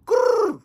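high, loud trilled "brrr"; punchy vocal ringtone; imitated phone ring. Imitates phone ringing in story.

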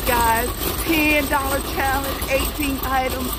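high, loud thrilled squeals; excited. Real, not acted. Shopper thrilled to viewers about penny items, excited and high. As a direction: gush over the penny finds. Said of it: "I am so excited".

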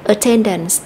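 Attendance with the middle syllable TEN stressed; at-TEN-dance, middle syllable punched. Shown as a demonstration. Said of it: "nhấn âm ten".

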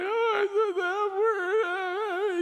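very high, drawn-out mock wail; hammed-up sobbing little boy; imitated child crying. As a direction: stretch the confession into a long squeaky bawl. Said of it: "He's crying".

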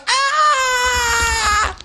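high piercing mock fangirl scream; fangirl shriek. Imitating fans screaming over Justin Bieber. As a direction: shriek like a swooning fan.

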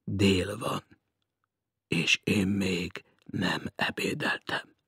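low, slow, hushed gloom; sepulchral voice. A narrator's reading. Hungry stranger laments missing lunch, sepulchral. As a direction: drag it out like a voice from the tomb. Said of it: "Síri hangon tette hozzá".

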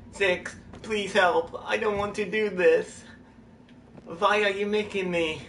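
high, pleading bad German accent; sucky German accent. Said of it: "That was sucky German accent I just did".

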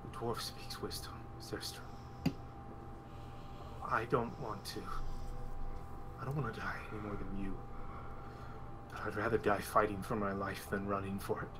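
put-on low, quiet, resolute young man; low grim resolve. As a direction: speak grimly to Zestra. NPC Adam low grim.

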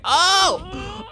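high, loud cry; mock cry. Player voicing a hit figure, high mock cry.

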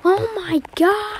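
a stunned, high outcry; shocked cry. Acted. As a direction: stretch it out in horror.

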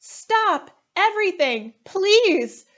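high, loud plea to stop; urgent call. Acted. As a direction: lunch monitor trying to restore order. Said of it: "Miss Dillo said".

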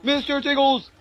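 high, loud gleeful yells; excited shouting. Real, not acted. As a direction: cheer him on at the top of your lungs. Cheering on the game summon.

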